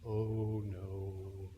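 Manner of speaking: low, near-breathless dread; a frightened hush as trouble turns your way; scared hush. Acted. Disciples dreading Jesus's look after the storm, low and hushed.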